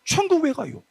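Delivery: sudden high, lighter question; old preacher's oratory. Acted. Youth preacher shows the old pastor switching pitch, high and light. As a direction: flip up high and ease the volume. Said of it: "높낮이 바꾸고 텐션을 바꾸는 거야".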